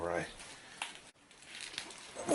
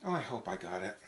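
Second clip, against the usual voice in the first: ordinary delivery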